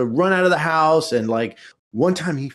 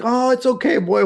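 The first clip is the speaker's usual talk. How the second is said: put-on high soothing kid voice; 10 semitones higher than usual. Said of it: "I'm like".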